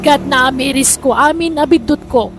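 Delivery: normal talking voice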